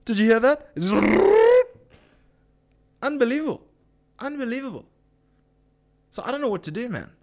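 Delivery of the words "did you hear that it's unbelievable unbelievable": high and much louder disbelief; almost shouting at the outrage; incredulous. Real, not acted. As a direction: vlogger stunned by the racket outside. Reacting to noise during rant.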